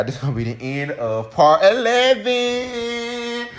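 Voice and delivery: loud, high, drawn-out sign-off; showy announcement. Pretend. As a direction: stretch the last word into a long high note. Announces end of the part.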